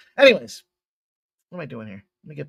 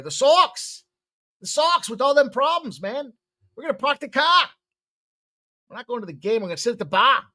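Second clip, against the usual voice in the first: higher, hammed-up Boston accent; put-on Boston accent; 7 semitones higher, 4 dB louder than usual. Host riffs on the Red Sox game, mock Boston accent. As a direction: play a rough Boston fan, dropping the r's. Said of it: "it's not my finest accent today".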